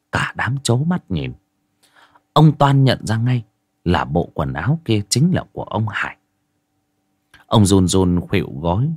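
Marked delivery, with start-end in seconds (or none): none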